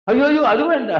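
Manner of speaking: a mother panicking at cancelling the visa; alarmed protest. Retold or imitated. Mother voiced refusing visa cancel.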